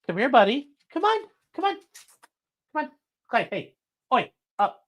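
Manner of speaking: high coaxing call to a pet; high pet-talk coaxing the dog to come. Real, not acted. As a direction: coax the dog over, high and sweet.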